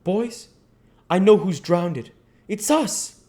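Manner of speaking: put-on high, loud triumphant boy; thrilled boy cries out his discovery; excited exclamation. A narrator's reading. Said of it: "he exclaimed".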